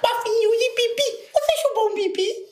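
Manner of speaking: put-on squeaky little-kid voice; childish voice. (voz infantil bem aguda)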